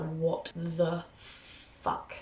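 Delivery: slow, soft disbelief; muttered disbelief; stunned aside. Retold or imitated. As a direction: let the shock come out slow. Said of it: "and I was like".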